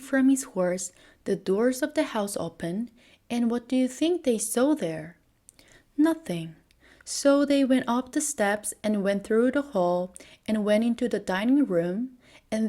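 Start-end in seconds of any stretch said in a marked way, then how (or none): none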